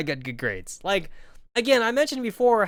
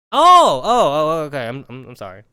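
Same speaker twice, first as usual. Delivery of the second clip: flustered apology; 3 semitones lower, 7 dB louder than usual. Retold or imitated. Embarrassed apology after faux pas.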